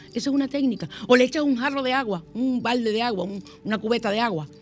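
normal talking voice

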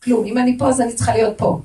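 high, loud protest; acted outburst of grievance; complaining. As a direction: whine loudly in indignant complaint. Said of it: "אני אבוא בטענות".

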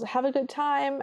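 a light hopeful call out the door; calling Cinderella. Retold or imitated. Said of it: "Cinderella calls".

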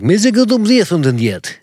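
high, angry pretend-Russian gibberish; mock Russian yelling. Imitating the Russian dude on a boat, pissed and yelling. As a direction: yell nonsense like an irate Russian. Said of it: "he's, like, pissed at her and yelling".

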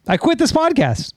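high-pitched mock resignation; hammed-up hurt protest; mock exasperation. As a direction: quit in playful indignation. Mock quitting after teasing.

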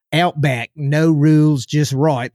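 amateur mock Australian accent. Shown as a demonstration. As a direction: try a rough Aussie accent on it. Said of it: "do a great Australian accent".

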